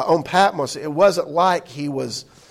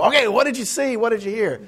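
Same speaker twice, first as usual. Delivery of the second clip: eager questions; 7 semitones higher than usual. Pretend. Eager listener questioning Paul.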